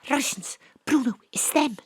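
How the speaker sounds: usual speaking voice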